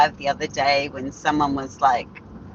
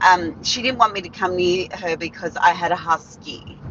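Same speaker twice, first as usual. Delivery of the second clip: ordinary talk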